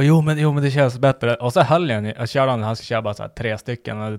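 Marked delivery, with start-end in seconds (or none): 0.0-1.3 mock loud urging. Retelling the gym guy's encouragement.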